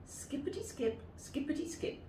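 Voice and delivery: high, soft skippity chant; playful skipping rhyme; skipping singsong. Acted. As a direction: skip the words lightly like a child. Narrator skipping along.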